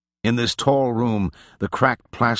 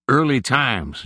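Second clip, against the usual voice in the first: lightly voiced old Nig, easy and unhurried; a hint of an old drinker naming his whiskey; old man's drawl; 6 semitones higher, 3 dB louder, slower than usual. Nig answers about the whiskey.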